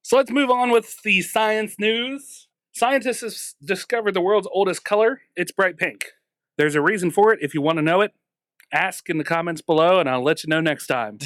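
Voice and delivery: bright, high show-host delivery; announcer voice. Acted. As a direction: hype it like a news anchor. Mock announcer science news intro.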